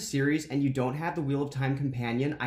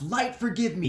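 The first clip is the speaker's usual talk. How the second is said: higher, pleading shout to the sky; acted shout; 6 semitones higher than usual. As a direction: beg the heavens for forgiveness. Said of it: "and he shouts".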